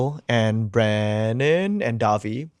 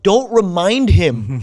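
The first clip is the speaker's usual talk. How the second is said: retold angry plea; 7 semitones higher, 6 dB louder, much slower than usual. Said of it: "am so pissed because I'm like".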